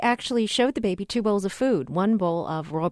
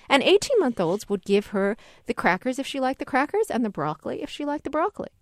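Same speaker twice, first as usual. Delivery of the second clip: ordinary talk, nothing marked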